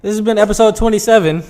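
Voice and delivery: sarcastically emphatic announcer line; sarcastic. Real, not acted. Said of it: "You see, that was sarcasm, guys".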